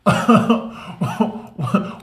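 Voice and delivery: high unvoiced giggle; high breathy laugh. Real, not acted. Laughing while retelling outburst at sister, high giggle.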